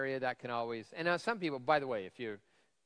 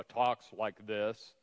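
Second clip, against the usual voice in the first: slow, booming preacher voice; drawn-out pulpit delivery; 5 dB louder, much slower than usual. Shown as a demonstration. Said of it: "one of those great preacher voices that go".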